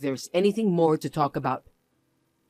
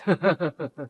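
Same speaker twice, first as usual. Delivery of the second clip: mocking laugh; 2 semitones lower than usual.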